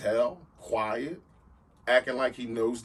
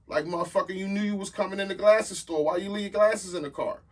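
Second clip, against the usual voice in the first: raised, higher indignant call-out; loud and high, calling out the shady guy; outraged retort; 8 semitones higher, 5 dB louder than usual. Retold or imitated. To the shady guy at the store, loud indignant call-out.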